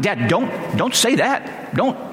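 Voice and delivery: high-pitched, slow plea to dad; exasperated pleading. Retold or imitated. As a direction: beg him to stop, drawing out each "don't". Son begging dad not to say.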